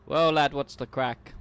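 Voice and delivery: slow, loud, hammed-up Irish accent; bad Irish accent. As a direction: do a cheesy Irish lad greeting, slowly. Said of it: "was the worst Irish accent I've ever heard".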